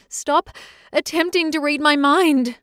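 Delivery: high, loud, furious cry; shouting in anger; scream. Acted. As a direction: yell at him to stop. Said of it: "I screamed".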